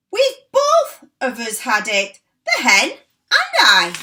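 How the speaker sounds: loud, high gleeful shout; shouting grandma. A narrator's reading. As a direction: shout down from the roof. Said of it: "Grandma shouted".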